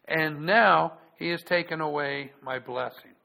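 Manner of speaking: loud, measured Bible reading; solemn public reading of scripture. Acted. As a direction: read it out like the pulpit Bible.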